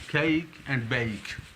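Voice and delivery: cake and bake very slow; model diphthong. Shown as a demonstration. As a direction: sound cake and bake out slowly. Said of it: "same ए and ई".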